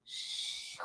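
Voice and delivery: soft breathy whoosh of a dropping plane; falling whoosh. Retold or imitated. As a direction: blow out a long falling shoo.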